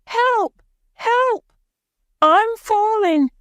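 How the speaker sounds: put-on very high, loud child's cry for help; terrified boy yelling for help; panicked shout. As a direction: scream it as you fall. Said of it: "He's shouting".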